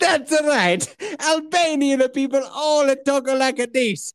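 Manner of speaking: high, slow, Italian-sounding Albanian; hammed-up Albanian with added vowels; mock Albanian accent. Shown as a demonstration. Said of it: "what's an Albanian sound like?".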